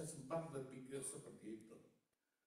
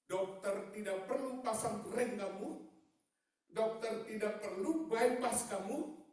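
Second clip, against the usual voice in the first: high, raised pulpit voice; full-force preaching; 9 semitones higher, 8 dB louder than usual. Real, not acted. Preacher crediting God with the bypass, full-force preaching. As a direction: build to a loud, stretched proclamation. Preaches doctor's words to congregation.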